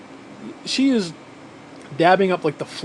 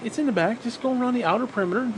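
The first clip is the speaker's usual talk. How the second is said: patient instruction; 6 semitones higher, 4 dB quieter, faster than usual. Retold or imitated. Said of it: "I'm like".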